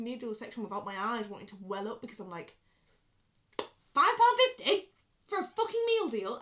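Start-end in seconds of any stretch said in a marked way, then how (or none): 3.6-6.4 much higher, louder, slow indignant outburst; outraged rant. Retold or imitated. Ranting at the meal deal section, loud slow outrage. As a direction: let the disbelief explode, word by word.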